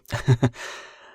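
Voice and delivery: a quick bright laugh at the clan drama; short laugh. Real, not acted. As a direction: laugh it off lightly. Amused laugh about clan tension.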